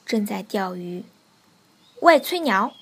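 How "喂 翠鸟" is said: loud, raised call for attention; shouting. A narrator's reading. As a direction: holler at someone across the pond. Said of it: "它嚷道". (大声喊叫)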